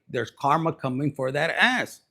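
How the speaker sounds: ordinary talk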